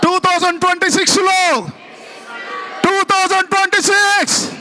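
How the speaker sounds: very high, loud rallying cry; full-force shouting. Real, not acted. Preacher leading congregation in declaring the year, full-force shout. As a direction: shout it out to fire up the crowd. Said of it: "గట్టిగా".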